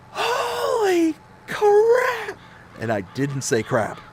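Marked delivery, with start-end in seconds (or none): 0.1-2.4 high, drawn-out scream of shock; full-blast shriek, long and high. Retold or imitated. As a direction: stretch each word into a panicked yell. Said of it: "I screamed".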